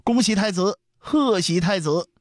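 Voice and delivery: very high, ringing youth's proclamation; loud congratulations. A narrator's reading. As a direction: hail the prince, stretching out the end. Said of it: "大声道". (少年大声道贺)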